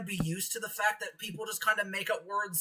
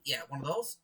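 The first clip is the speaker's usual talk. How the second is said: usual speaking voice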